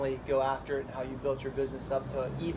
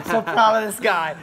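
proud and excited; 5 semitones higher, 10 dB louder than usual. Real, not acted. Praising guest to camera.

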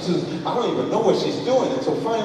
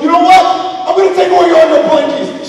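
loud, high-pitched ultimatum; retold parental shouting; angry dad threat; 11 semitones higher, 11 dB louder, faster than usual. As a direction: threaten punishment at full volume. Dad threatens to take blankies.

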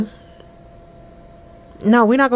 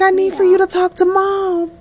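voicing Amina; 11 semitones higher than usual. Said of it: "She's like".

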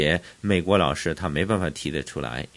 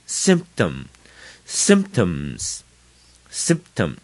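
symptom slowly, weight on SYM; front stress; 2 semitones lower, much slower than usual. Shown as a demonstration. Teacher drilling new words, symptom stressed on SYM. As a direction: punch SYM in symptom, drop the tom. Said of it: "重音在，在最前面".

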